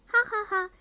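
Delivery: high taunting ha ha ha; mocking cackle. Peeves taunting Filch, high mocking cackle.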